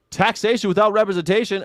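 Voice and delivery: high, loud rallying cry; loud slogan. Real, not acted. Host reading a chat slogan aloud, rallying cry. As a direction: call it out like a protest chant.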